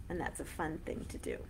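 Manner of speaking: ordinary delivery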